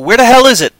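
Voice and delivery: loud, high mock-frustrated cry; exasperated outburst. Host retelling an edit hunt, loud exasperated outburst. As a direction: burst out as if searching in vain.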